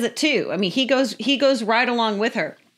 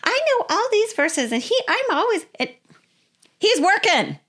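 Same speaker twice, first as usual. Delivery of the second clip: high, complaining wife voice, far higher pitch; frustrated wife; 8 semitones higher than usual. Retold or imitated. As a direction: whine it like a wife fed up with her husband. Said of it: "have been like".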